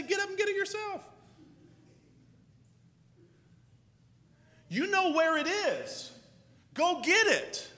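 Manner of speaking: a hint of parents telling him off, high; mild parent scolding. Parents telling the kid on the couch off, mild scolding. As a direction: brush off the lazy kid, lightly voiced.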